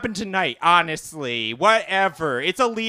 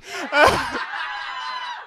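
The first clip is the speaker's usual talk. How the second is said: high shrieking laugh. Real, not acted. Big laugh.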